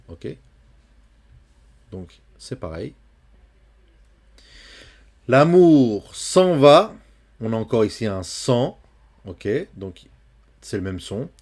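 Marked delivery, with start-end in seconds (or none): 5.3-6.9 vers lu lentement et plus fort; poem reading. Shown as a demonstration. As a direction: lire le vers en appuyant. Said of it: "lecture lente du vers". (lecture de poème lente)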